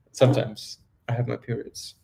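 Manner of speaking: ordinary talk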